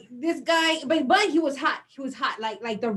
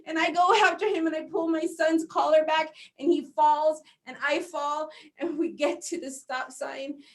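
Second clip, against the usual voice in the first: ordinary talk, nothing marked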